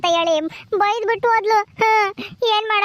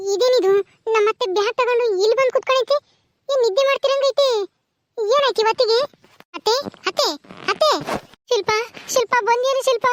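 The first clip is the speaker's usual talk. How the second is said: put-on a higher, quicker young woman's voice, surprised; young daughter-in-law; 4 semitones higher than usual. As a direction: play the startled young wife finding her mother-in-law. Young wife figure, put-on voice. (ಅತ್ತೆಯನ್ನು ಬಾಗಿಲಲ್ಲಿ ಕಂಡು ಅಚ್ಚರಿಪಡುವ ಸೊಸೆ, ಎತ್ತರದ ಧ್ವನಿ)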